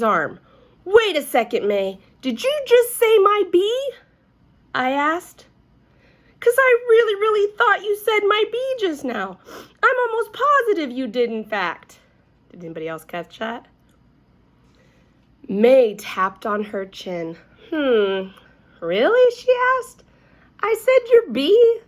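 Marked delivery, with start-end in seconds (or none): 0.8-12.0 excited. A narrator's reading. Junie grabbing May's arm excitedly. 17.7-21.8 innocent. A narrator's reading. May feigning innocence.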